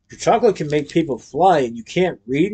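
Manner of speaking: ordinary delivery, nothing marked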